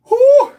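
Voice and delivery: high loud whew from the heat; sharp exhale. Real, not acted. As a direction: blow out the burn. Reacting to spicy sauce.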